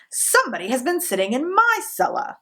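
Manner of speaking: papa bear's gruff voice; great rough gruff voice. A narrator's reading. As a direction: play the big father bear, rough and gruff. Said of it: "in his great rough, gruff voice".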